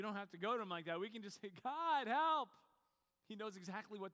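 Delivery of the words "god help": loud, high, desperate plea; crying out. Acted. Preacher showing how simply one can pray to God, crying out loud. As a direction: cry it out at full voice. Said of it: "Just cry out to him".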